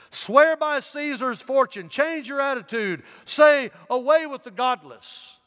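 high and loud pressing demand; urging. A narrator's reading. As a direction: push him hard, high and loud, to recant. Proconsul urging Polycarp to recant.

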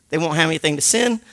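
ordinary delivery

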